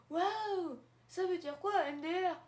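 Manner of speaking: put-on high wide-eyed wow from a future kid; mock amazed kid. Future child viewer asking what MDR means, high and amazed. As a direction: play a puzzled child.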